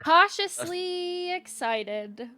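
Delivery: slow, high, drawn-out; slow high cautious. Acted. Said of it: "what mood their character is in".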